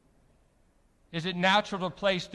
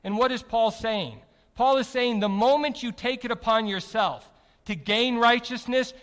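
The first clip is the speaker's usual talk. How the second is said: usual speaking voice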